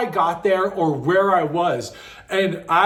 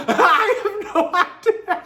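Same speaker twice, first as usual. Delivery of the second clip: high words breaking into laughter; can barely talk for laughing; giggling high confession; laughing helplessly; 11 semitones higher than usual. Real, not acted. Laughing at not knowing why.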